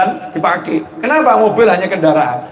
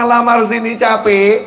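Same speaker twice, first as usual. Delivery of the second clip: ordinary talk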